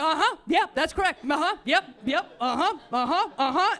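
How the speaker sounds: mocking dad's endless phone replies; mock endless uh-huhs. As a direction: hammer out the endless phone yeps. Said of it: "it felt like an hour and a half".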